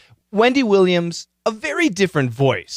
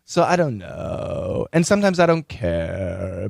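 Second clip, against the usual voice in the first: vocal fry; 4 semitones lower than usual. Shown as a demonstration. Teacher demonstrates vocal fry to podcasters in a low creak. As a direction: let the voice crackle and sink low. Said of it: "this is the idea of vocal fry".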